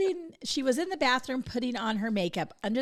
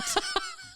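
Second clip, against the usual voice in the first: high laugh. Real, not acted. Laughing at joke.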